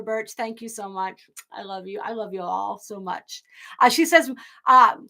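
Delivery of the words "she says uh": normal talking voice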